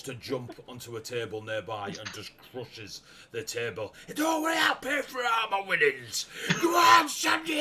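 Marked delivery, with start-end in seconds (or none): 4.1-7.6 high, loud football hooligan roar; frenzied high-pitched yelling; shouting and screaming. Acted. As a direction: scream encouragement like a rowdy punter. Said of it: "he's shouting and screaming in your face".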